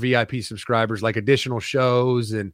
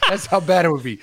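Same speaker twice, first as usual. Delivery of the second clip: ordinary talk, nothing marked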